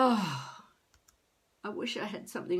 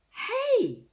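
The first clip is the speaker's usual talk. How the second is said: excited hey; 6 dB louder than usual. Real, not acted. Excited realization.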